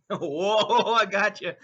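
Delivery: whoa through a real laugh. Real, not acted. Laughs at own number joke.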